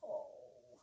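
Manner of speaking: soft whisper; hushed aside. Real, not acted.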